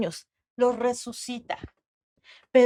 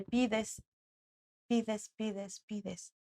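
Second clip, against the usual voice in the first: each pides softer until almost gone; fading away; 12 dB quieter, much slower than usual. Real, not acted. Preacher mocks one-sided prayer, fading to softer. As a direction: let the repetition die out to nothing.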